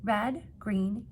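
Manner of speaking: normal talking voice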